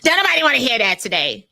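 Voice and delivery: a hint of a grumpy patient, quick. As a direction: brush off the singing nurse. Grumpy patient rejects the song.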